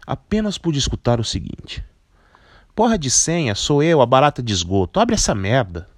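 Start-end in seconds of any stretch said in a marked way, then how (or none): 2.7-5.9 put-on loud, cheeky young woman shouting; jovial loud voice. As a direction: bark it through the intercom, annoyed. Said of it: "Uma voz jovial".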